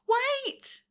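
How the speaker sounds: high, loud shout. A narrator's reading. Elizabeth stopping the dragon at the door, shouted. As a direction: call out sharply to stop someone. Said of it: "shouted Elizabeth".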